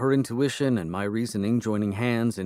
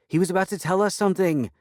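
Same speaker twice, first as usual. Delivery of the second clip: fast, high, pressing plea; urgent plea; 10 semitones higher, 4 dB louder, faster than usual. Acted. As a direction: rush it out to stop them. Kayla stops the cat attack.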